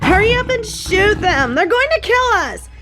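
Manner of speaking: shrill panic as lions charge; frantic high-pitched plea; panicked high pleading. Acted.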